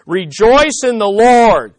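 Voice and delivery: slow, loud proclamation "Rejoice in the Lord"; Paul's loud command. Retold or imitated. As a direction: call it out to the whole congregation. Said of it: "he's saying to the Philippians".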